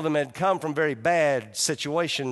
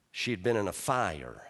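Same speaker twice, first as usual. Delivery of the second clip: ordinary delivery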